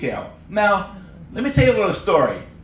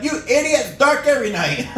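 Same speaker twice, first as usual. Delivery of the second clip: yelling back; 8 semitones higher than usual. Retold or imitated. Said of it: "I yell back".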